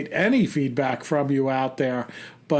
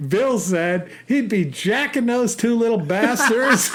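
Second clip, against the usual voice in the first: high-pitched, cracking up; laughing while reading; 7 semitones higher than usual. Real, not acted. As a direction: read it out through laughter. Reading funny email, cracking up.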